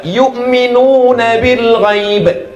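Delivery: very slow "Yu'minuna" with stretched vowels; slow recitation demo; long vowel demo. Shown as a demonstration. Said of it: "ada panjang ke depan".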